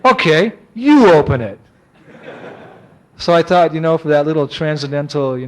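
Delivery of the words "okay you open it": slow, louder grudging concession; backed-off customs officer. Retold or imitated. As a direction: imitate a grouchy man giving in. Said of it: "he kinda backed off and he said".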